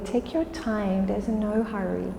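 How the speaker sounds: normal talking voice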